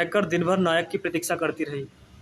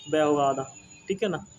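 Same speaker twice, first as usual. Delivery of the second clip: usual speaking voice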